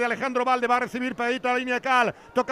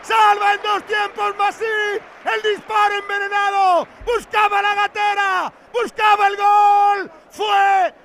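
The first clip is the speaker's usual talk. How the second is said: higher, louder roaring commentary; excited shout; 5 semitones higher, 6 dB louder, much slower than usual. Real, not acted. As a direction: yell the chance at full force. Near goal, live call.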